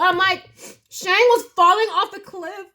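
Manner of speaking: high-pitched wailing through tears; crying. Real, not acted. Reader reliving a tragic cartoon scene for viewers, tearfully wailing. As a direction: retell it sobbing, loud at first, then fading. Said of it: "the time I cried during Mulan two".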